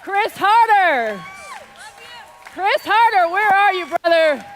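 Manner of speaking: loud, high shout across the crowd; calling out. Real, not acted. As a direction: holler out a name to find someone. Calling out people from stage.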